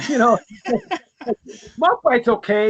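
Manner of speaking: chuckling through the words; laughing. Real, not acted. Cohost laughing as he grudgingly rates the artist. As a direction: laugh it off, half teasing.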